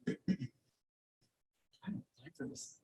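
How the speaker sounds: breathy whispered objection; whispered grumble. Retold or imitated. Teacher grumbling under breath at the plan meeting, whispered.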